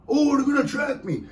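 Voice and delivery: lightly voiced worried nephew; mocking nephew. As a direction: send up the nephew's tracking fear. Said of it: "my nephew says that".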